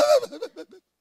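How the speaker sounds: high, squeaky words through laughter; helpless laughter breaking into speech; real laughing fit. Real, not acted. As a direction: crack up and struggle to get the words out. Cracks up while telling.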